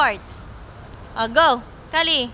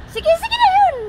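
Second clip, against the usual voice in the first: much higher, loud urging on; excited cheering; 6 dB louder than usual. Real, not acted. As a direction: push her on, thrilled. Cheering child near finish.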